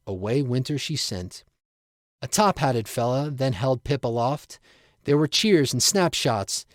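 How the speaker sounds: ordinary delivery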